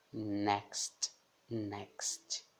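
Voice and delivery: NEXT com X soando KS; som de X inglês. Shown as a demonstration. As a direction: marque o KS no meio de NEXT. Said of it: "sempre o som de X". (NEXT, X pronunciado como KS)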